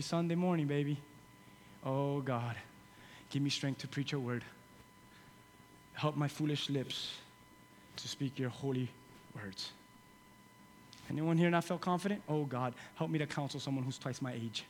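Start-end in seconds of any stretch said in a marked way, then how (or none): 1.8-9.7 soft, low, slow mock prayer; hushed prayer. Mock prayer before preaching. 12.3-14.6 hushed prayer. Pretend. Mock prayer.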